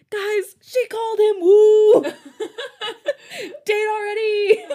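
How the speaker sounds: high excited laughing shout. Acted. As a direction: squeal it out gleefully. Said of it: "Jongho shouted".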